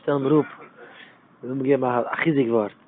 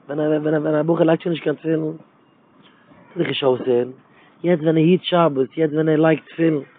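ordinary talk

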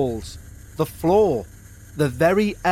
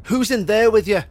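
higher, louder alarmed shout; 6 semitones higher, 5 dB louder than usual. A narrator's reading. Father shouting through locked door at unseen intruder, loud. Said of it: "shouted Alan".

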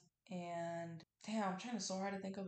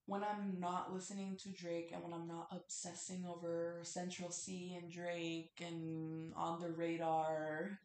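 ordinary talk

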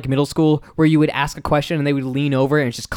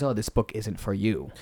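put-on low, soft old-man voice; cautioning old man; 7 semitones lower, 9 dB quieter than usual. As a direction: quietly warn the kid off, in character. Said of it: "the old man says".

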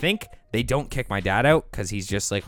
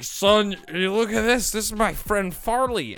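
put-on drunk dad; 10 semitones higher, slower than usual. Dad voiced in drunk phone story.

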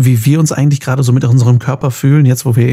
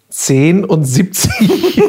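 laughing; 6 semitones higher, much slower than usual. Real, not acted. Joke about felt age.

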